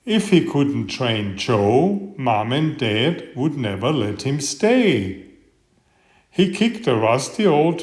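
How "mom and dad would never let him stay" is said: ordinary delivery, nothing marked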